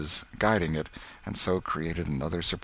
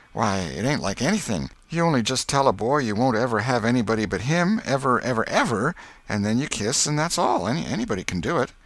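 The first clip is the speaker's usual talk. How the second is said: put-on higher, eager boy explaining; persuasive boy Tom; 5 semitones higher, 4 dB louder than usual. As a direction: talk her into it, schoolboy style.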